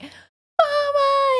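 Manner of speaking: put-on high, wailing toddler "Mama"; a toddler crying after his mom; mock toddler wail; imitating toddler crying. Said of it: "he's, like, crying".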